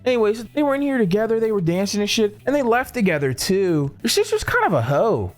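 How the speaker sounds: lightly voiced chatty server; gossipy server. Server telling them about a good looking guy, lightly put-on gossipy voice.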